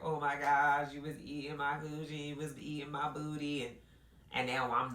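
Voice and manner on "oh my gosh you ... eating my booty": higher-pitched mock wail; put-on sobby lament, pitched up; mock crying. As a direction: imitate her whiny, tearful complaint. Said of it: "She's now crying like".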